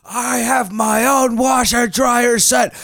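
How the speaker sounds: slow, high, loud wail; hammed-up lament, dragged out; mock despair shout. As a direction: yell it slowly like a broken man. Acting divorced laundromat guy.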